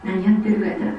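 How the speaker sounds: ordinary talk, nothing marked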